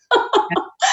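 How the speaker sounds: high unvoiced giggle of delight; giddy laugh. Real, not acted. Host giggles after getting goosebumps, high airy laugh. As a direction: let a high airy giggle slip out, moved. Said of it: "I just got the goosies".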